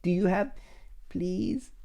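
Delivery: high-pitched, halting begging; hammed-up desperate begging; mock pleading. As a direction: plead pitifully to be let in. Begging at a closed restaurant.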